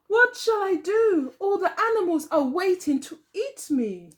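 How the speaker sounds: put-on worried old woman. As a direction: plead in despair. Worried Buri asks daughter.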